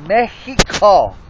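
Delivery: slow Mexico with a breathy Spanish h, "Méhico"; Mexico in a Spanish accent, slowly. Shown as a demonstration. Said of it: "Say that with an accent".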